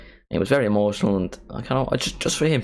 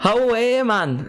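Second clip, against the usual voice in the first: high, exaggerated copy of Geordie; Geordie accent attempt; 13 semitones higher, slower than usual. Shown as a demonstration. Streamer copying an accent tutorial, high Geordie attempt. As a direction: mimic a Geordie call, high-pitched. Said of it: "look up a Newcastle accent".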